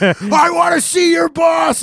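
high, drawn-out mock shout; sergeant's demanding yell. Marine first sergeant demanding the airman's boss, shouting. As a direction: holler it slowly as an irate sergeant.

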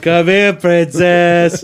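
high, loud, sweet cooing; cooing boyfriend. Retold or imitated. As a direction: coax her in sweetly. Imitating Robert's call.

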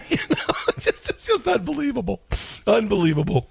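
high, breathless laughing through words; can barely get it out for laughing; laughing disbelief. Real, not acted.

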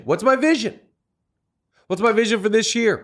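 lifted, questioning self-talk. Acted. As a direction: ask it up high, like wondering aloud. Asks himself the question aloud.

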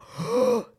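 sharp shocked gasp; quick high intake of breath. Retold or imitated. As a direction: gasp in surprise at the missing tooth. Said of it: "They're like".

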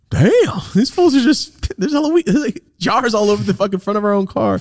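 squeaky driver-by laughing at fools; high mocking passerby. Passing drivers mocking them.

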